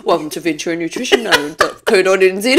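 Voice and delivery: fast, rattled-off promo; fast mock plug read; talk like him. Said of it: "let's talk like you".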